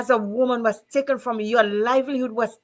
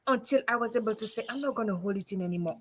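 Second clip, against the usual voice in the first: emotional, quiet; 9 dB quieter, faster than usual. Real, not acted. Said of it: "I'm getting emotional".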